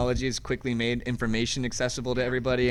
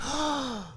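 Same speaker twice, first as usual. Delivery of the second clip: mock gasp; 13 semitones higher, 5 dB quieter than usual. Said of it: "they're like".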